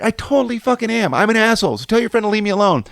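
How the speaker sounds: retold proud comeback, pitched high; defiant admission. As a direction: own the insult defiantly. Acted defiant answer to friend.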